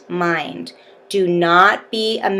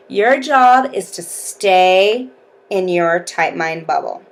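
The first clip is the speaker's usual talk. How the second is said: normal talking voice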